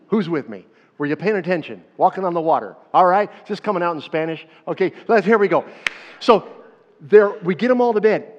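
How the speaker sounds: loud, energetic preaching; rousing the crowd. Real, not acted. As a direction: wake the congregation up.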